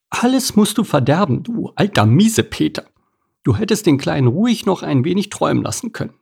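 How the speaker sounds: put-on high, reproachful old crab lady; scolding crab. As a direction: scold the old grouch for spoiling it. Edith scolding Karl.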